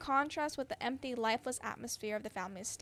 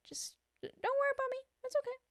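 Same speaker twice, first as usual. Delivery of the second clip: much higher, tiny nervous voice; squeaky nervous; 12 semitones higher than usual. Acted. New stranger reassuring the staring diners, much higher. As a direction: squeak it out awkwardly.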